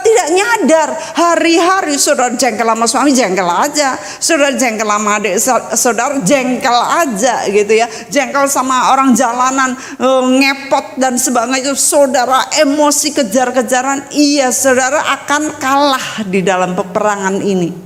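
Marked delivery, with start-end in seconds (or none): none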